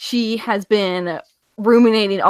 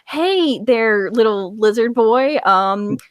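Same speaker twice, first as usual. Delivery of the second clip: put-on southern accent; 4 semitones higher than usual. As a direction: play her in a light Southern accent, higher than usual. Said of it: "Man, your southern accent just came out".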